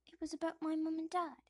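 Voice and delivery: put-on young Harry. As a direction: explain earnestly. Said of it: "says young Harry".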